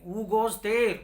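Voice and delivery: loud shouted challenge; monster's shout. Acted. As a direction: call out to the stranger like a guard. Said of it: "shouted loudly".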